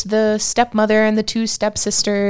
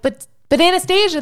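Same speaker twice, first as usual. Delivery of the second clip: pleading Cinderella; 12 semitones higher, faster than usual. Retold or imitated. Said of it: "And it's like".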